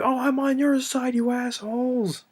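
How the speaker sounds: high, put-on retelling of McClane's snarl; mock McClane. Retelling Die Hard, McClane mocks the FBI shooting at him, high put-on voice. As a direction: pitch way up, mocking the line. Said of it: "And it's like".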